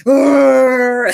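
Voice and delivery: loud, high mock war cry; battle yell. Rock firing the Gatling gun down the tunnel, hammed-up battle yell. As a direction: let out a hammed-up roar of rage. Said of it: "screaming like".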